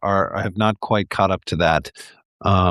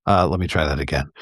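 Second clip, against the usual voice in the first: usual speaking voice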